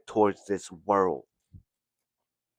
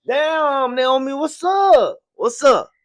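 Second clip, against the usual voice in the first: 나오미를 향해 훨씬 높고 크게 외침; 높은 소리로 반갑게 부르기; calling out; 6 dB louder, slower than usual. Real, not acted. Calls out to Naomi.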